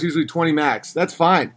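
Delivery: usual speaking voice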